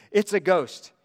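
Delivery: frightened cry. Acted. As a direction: blurt it out as if you'd seen a ghost.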